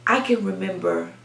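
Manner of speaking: normal talking voice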